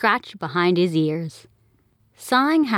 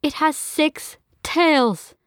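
slow, higher mother's voice; a nervous mom, drawn out and high; mom pointing out; 9 semitones higher, much slower than usual. Acted. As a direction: spell it out slowly, uneasy. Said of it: "Mom pointed out".

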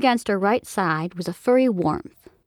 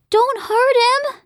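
high, loud, slowed plea; much higher, louder desperate kid shout; child's protective cry; 5 dB louder, slower than usual. Acted. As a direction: shield him with your voice, a little girl crying out. Girl protects monster from parents.

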